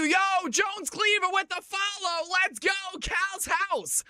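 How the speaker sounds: hype shout. Real, not acted. Hype call on a play.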